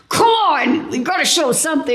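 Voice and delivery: high, urgent prodding; pushy doctor. Retold or imitated. Doctors pushing to find something, impatient and high. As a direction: hurry them to find something.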